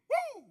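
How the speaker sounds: high party whoop. Acted. Team celebrating a lost game, high whoop. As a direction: whoop like you won anyway. Cheering even after losing.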